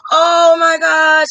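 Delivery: slow, loud gasp then oh my gosh; gasping delight. Pretend. Imagined recipient marvelling at the blanket, slow gasp. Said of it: "You want somebody to say".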